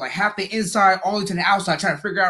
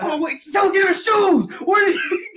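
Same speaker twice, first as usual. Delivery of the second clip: mock frantic; 11 semitones higher than usual. Crowd hunting for shoes.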